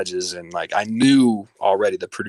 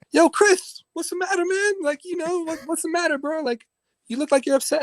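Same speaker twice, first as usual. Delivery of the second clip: imitating [name]. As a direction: squeak it out like a cheery host brushing him off. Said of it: "And he's like".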